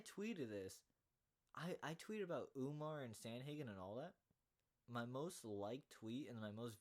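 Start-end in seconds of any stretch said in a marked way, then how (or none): none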